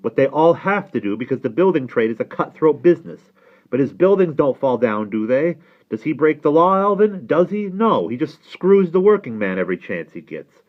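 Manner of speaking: insistent, rising rhetorical questions; father arguing back. Acted. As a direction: hammer the argument home to your nephew. Father argues with nephew.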